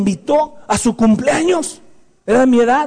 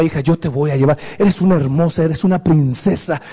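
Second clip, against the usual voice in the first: tender father; 8 semitones lower than usual. Shown as a demonstration. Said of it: "y le diga".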